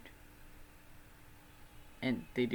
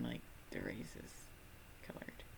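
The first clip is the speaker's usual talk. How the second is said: whisper; 13 semitones lower, 15 dB quieter than usual. Real, not acted. Whispered awkward clarification.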